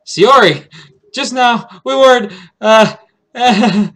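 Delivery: high, loud and caught off guard; flustered surprise. Acted. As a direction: blurt it out, flustered, then falter. Caught out by Shiori's arrival.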